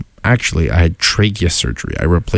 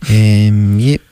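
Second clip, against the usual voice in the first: put-on New Zealand accent; 4 semitones higher than usual. Teasing Ben about rocks, New Zealand accent. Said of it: "New Zealand person talk".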